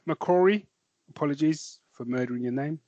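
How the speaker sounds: ordinary talk, nothing marked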